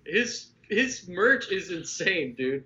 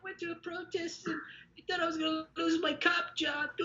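squeaky mock caller; 12 semitones higher, 7 dB quieter than usual. Mocking caller voice.

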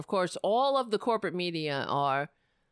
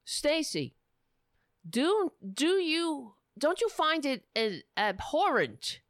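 higher, slowed mock interview question; imagined interviewer; 7 semitones higher than usual. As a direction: act out an interviewer pressing a guest. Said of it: "they could have asked Stacey Abrams".

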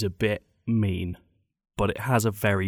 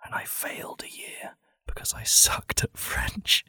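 ASMR whisper; 5 semitones higher, 5 dB quieter than usual. Pretend. Comedian reading a sad story like it's ASMR, whispering. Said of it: "like it's A S M R".

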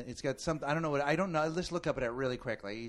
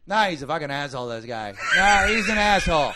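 loud, fast trash talk over the fence; loud mock of the guy cursing; loud insult; 4 semitones higher, 13 dB louder than usual. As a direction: shout it to the neighbor. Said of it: "I hear over my fence".

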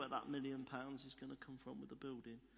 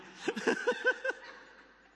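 loud, high burst of real laughter; hearty laugh; 10 dB louder than usual. Real, not acted. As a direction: let out a big laugh. Laughs at own Welsh impression.

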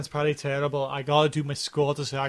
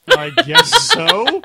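laughing; 13 semitones higher, 7 dB louder, much slower than usual. Real, not acted. Laughing answer to question.

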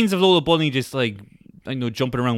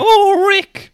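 much higher, loud delighted squeal; mock excited shout; 7 dB louder than usual. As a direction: squeal the name like a thrilled fan. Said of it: "just like, "Hey, we found your weird badger".

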